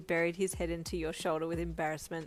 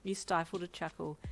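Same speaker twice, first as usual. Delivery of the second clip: plain reading voice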